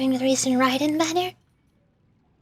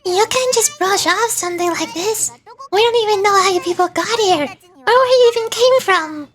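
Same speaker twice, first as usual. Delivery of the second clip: high character voice; 6 semitones higher, 4 dB louder than usual. Acted. Voices Paimon-like companion.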